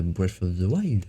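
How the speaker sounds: game title with an English accent. Real, not acted. Gamer naming an older Zelda game, English accent. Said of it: "j'ai mis l'accent anglais là".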